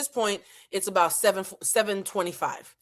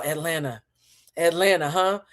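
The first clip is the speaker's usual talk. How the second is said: ordinary talk, nothing marked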